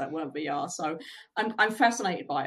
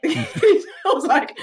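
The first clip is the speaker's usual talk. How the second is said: speaking through a burst of laughter; real laugh mid-story; 11 semitones higher, 11 dB louder, much slower than usual. Real, not acted. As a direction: laugh as you get the words out. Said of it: "laughing while telling".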